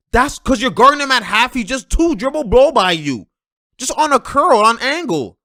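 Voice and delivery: loud, high-pitched exasperated breakdown; exasperated rant. Real, not acted. Analyst scolding PG for guarding Luka at half court, exasperated. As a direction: hammer each mistake, voice pushed up and loud. Ranting at defensive mistakes.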